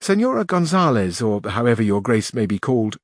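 plain reading voice

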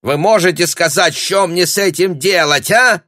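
high, loud, heated question; shouted demand. Acted. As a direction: yell for an answer, exasperated.

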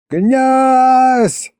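high, long-held calling shout; yelling. A narrator's reading. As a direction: holler for the prince up at the wall. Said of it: "снова заорал я". (протяжный вопль)

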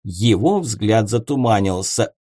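plain reading voice

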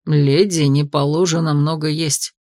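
low, slow ghostly voice; a disapproving old spirit; stern ghost. Acted. Поддакнул призрак.

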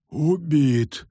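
gasped, shocked cry; gasping in shock. A narrator's reading. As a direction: gasp it out in horror. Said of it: "ахнул он".

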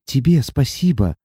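ordinary delivery

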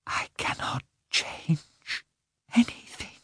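slow hushed whisper. A narrator's reading. Wife whispering her refusal to her husband. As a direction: breathe it out, defeated. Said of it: "she whispered".

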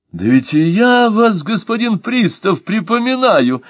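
high, happy recognition; light up with joy as you recall him; delighted. Acted. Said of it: "обрадовался актёр". (радостно, высоким голосом)

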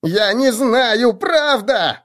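high, slow squeal; high-pitched desperate shriek; shrieking halfling. A narrator's reading. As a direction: squeal out the denial. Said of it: "взвизгнул большой втык".

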